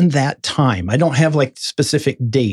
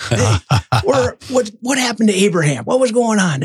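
put-on eager kid question; 8 semitones higher than usual. Kids phoning the pastor.

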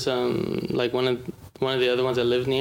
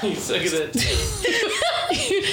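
high, slow words squeezed out through laughter; can barely talk for laughing; laughing hard; much slower than usual. Real, not acted. Teasing a friend over a bad joke, laughing hard.

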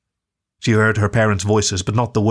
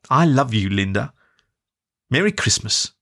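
tender mum; 2 semitones higher than usual. Acted. Mum consoling her daughter on a video call, tender. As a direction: comfort your daughter gently.

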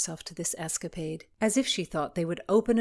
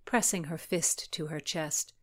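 ordinary delivery, nothing marked

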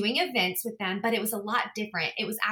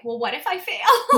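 mock fearful; 8 semitones higher, 8 dB louder than usual. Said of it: "fearful of".

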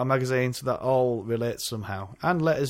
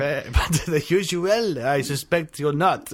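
slow, higher mock-French drawl; drunken Frenchman; 3 semitones higher, 3 dB louder, slower than usual. Podcaster joining in the bar joke, drunken French accent. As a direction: play a tipsy Frenchman with a silly accent. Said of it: "drunken Frenchman".

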